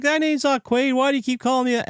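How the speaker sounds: very high, frantic mock protest; retold losing it. Doug protesting to his captors, high mock hysteria. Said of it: "he's losing his mind".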